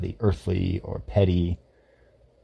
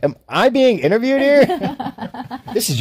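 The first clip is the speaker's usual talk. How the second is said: mock surprise; 5 dB louder than usual. Real, not acted. Playfully protesting to his co-host that he's being interviewed, surprised. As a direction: pitch jumping way up in playful disbelief.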